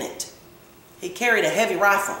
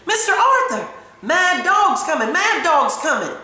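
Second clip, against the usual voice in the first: high-pitched urgent calling out; shouting; 9 semitones higher than usual. A narrator's reading. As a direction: shout a warning at a closed door, pitch way up. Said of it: "and she shouted".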